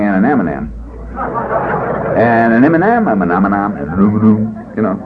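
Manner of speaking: muddled stumbling. Retold or imitated. Four-year member still fumbling the word, mock befuddled. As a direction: stumble round the same syllables until they blur. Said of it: "still saying".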